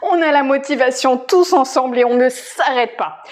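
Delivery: ordinary talk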